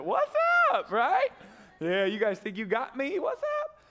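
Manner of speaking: high, loud, laughing taunt; taunting shout. Acted. Preacher taunting the enemy as if God had his back, high laughing crow.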